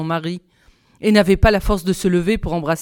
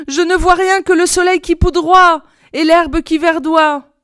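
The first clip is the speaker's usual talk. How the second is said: high, loud reply from a tower; raised, carrying young sister voice; sister on lookout; 8 semitones higher, 6 dB louder than usual. A narrator's reading. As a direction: call the answer down.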